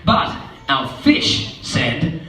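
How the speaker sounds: ordinary delivery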